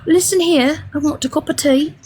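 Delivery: mock British voice; mock English accent. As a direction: play a fussy Englishman asking for tea. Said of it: "He'll be like".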